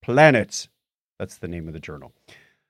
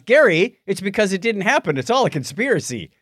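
mock conspiracy theorist; 7 semitones higher than usual. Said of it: "to which Steve Lennon responds".

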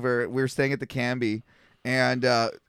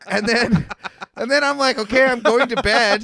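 laughing through it; 7 semitones higher, 5 dB louder than usual. Real, not acted. Laughing at own dumb plan.

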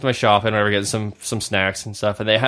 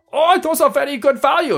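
high mock broken English; mock cashier accent, pitched up; cashier accent impression; 4 dB louder than usual. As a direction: put on a thick accent as the cashier. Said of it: "the worst impression".

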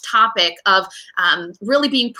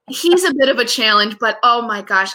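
usual speaking voice

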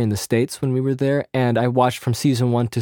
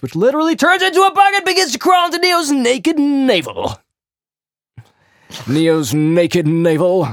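dramatic narrator; 4 semitones higher than usual. Acted.

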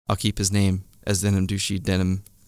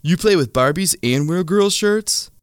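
higher and slow, sneering; mocking douchey guy; 9 semitones higher, slower than usual. A narrator's reading. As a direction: tease the little kid. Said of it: "the douchey denim guy".

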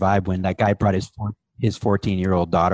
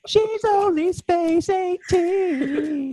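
mock whine; much slower than usual. Mock plea, high crying.

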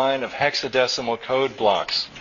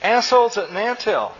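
high-pitched, chirpy parroting; chirping child; 7 semitones higher than usual. A narrator's reading. Small son repeating dad's rude phrase, chirpy. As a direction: chirp it back like a little kid. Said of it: "chirped Chew".

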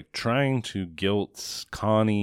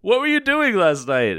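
mock angry pimp; 8 semitones higher than usual. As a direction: chew her out like a pushy pimp. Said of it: "So the pimp is like".

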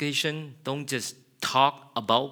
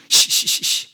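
hushing shh; 8 dB louder than usual. Acted. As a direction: hush him gently, breath only. Father hushing the son.

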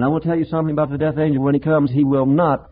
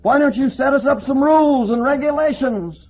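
much higher, insistent demanding voice; nagging religious critics; 10 semitones higher than usual. Retold or imitated. Preacher mimics religious people nagging him to preach law, much higher. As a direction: hound him with pushy questions. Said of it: "religious people who say".

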